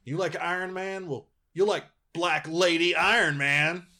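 high, mocking sales pitch; sarcastic pitchman voice; mocking Marvel. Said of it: "Marvel's been doing this thing".

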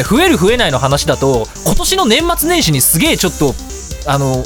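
usual speaking voice

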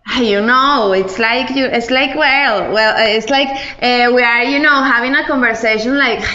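lightly mimicked Venezuelan accent on English words; Caracas accent in English. She imitates how a caraqueña speaks English, light Venezuelan accent. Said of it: "es una caraqueña hablando en inglés".

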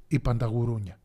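ordinary delivery

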